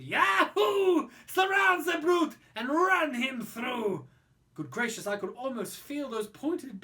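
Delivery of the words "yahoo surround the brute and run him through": high, loud battle yell; screaming mob. Acted. French mob screams as they charge the snail, loud and high. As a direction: charge in with a whoop. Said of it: "They screamed".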